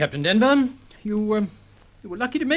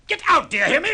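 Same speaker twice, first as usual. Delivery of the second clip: a high, shrill shout to leave; furious order; 12 semitones higher, faster than usual. Acted. Shipowner ordering the captain out, in a furious high shout. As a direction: order him out at the top of your voice.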